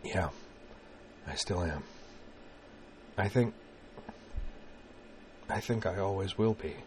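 hushed, halting near-whisper; soft admission with long pauses. A narrator's reading. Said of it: "said in a whisper".

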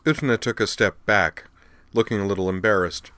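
ordinary delivery

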